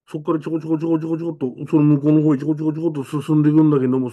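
速く刻むように; quick patter. Retold or imitated. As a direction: rattle off the tiny steps fast. Mimics small hurried steps. (小さな足跡を、速く刻むように)

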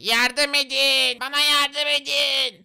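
slow, soft, far-away cry for help; calling for help. Acted. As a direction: draw out a distant plea. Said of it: "diye bağırıyor". (uzaktan, uzatarak yardım çağırışı)

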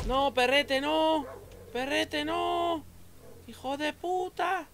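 frantic high pleading; panicked pleading. Real, not acted. Dog eats his mushrooms.

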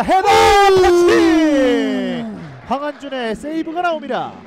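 loud, high, drawn-out call; excited shout. Real, not acted. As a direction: yell the save live. Header and save call.

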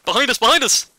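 urgent yell, high and loud; shouting warning. Real, not acted. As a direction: shout it over gunfire. Squad under fire, warning.

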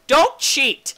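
high, loud warning; mock scolding. Player warning viewers not to cheat, mock scolding. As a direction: shout a playful telling-off.

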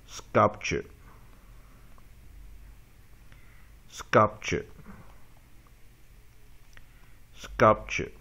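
"Sculpture" stressed on SCULP with short uh, falling; isolated model of "Sculpture", weak "ch-er" ending; practice model. Shown as a demonstration. Teacher practising two words with students, stress on SCULP in "Sculpture". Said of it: "我们再把这两个单词再练习一遍".

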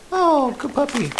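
high, slow sing-song praise. Real, not acted. Praising the puppy for taking a treat, in high slow baby talk. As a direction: coo at the puppy.

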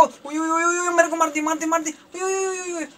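high, thin, whimpering yelps; panicked yelping. Real, not acted. Gamer crying out that he is getting killed, high panicked whimper. Player being hit in the game.

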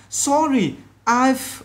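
"I've" with the final v sounded; high, careful model of "Sorry, I've"; clear final sound. Shown as a demonstration. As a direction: let the end of "I've" be heard. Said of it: "âm cuối này".

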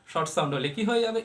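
ordinary talk, nothing marked

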